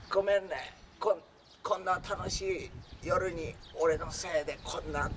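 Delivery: put-on weak, slow, drawn-out apology of the hurt boy; 弱々しくゆっくり謝る男の子; feeble voice. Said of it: "めちゃめちゃ弱々しい声で".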